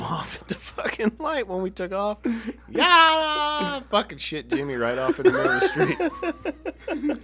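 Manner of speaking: laughing hard through the story; can barely get it out for laughing. Real, not acted. Telling friends how he lost Jimmy, laughing.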